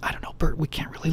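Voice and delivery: hushed, hesitant whisper; whispered reply. Retold or imitated. Guest whispering doubts to Bert amid crowded party. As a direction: whisper back so nobody hears.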